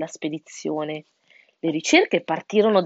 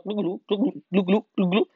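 throaty turkey gobble at normal pitch; gobbling turkey. A narrator's reading. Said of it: "gorgogliò Ernesto il tacchino". (gorgoglio di tacchino)

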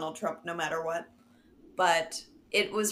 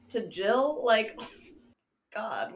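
ordinary talk

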